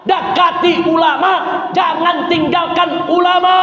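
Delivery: rally them to the ulama; fiery preaching. Acted. Preacher urging the congregation to follow the ulama.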